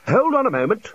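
high, sudden exclamation; interrupting senior wizard. Acted. Senior wizard halting the talk, sudden exclamation. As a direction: cut in with a bright idea.

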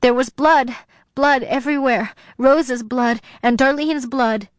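high, frantic outpouring; half out of her mind. A narrator's reading. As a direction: blurt it out as if reliving horror. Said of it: "half out of my mind".

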